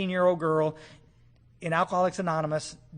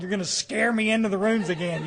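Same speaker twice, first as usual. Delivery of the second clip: normal talking voice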